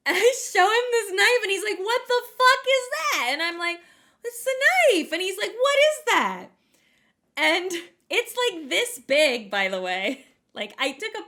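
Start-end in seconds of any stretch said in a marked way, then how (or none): 1.8-3.3 high, loud, drawn-out mock outrage; shocked. Husband reacting to the rusty knife, shocked. 4.2-5.1 high, loud mock protest; defensive. Wife answering her appalled husband, defensive. 5.5-6.5 high, loud imitation of alarm; shocked. Husband questioning the knife, shocked.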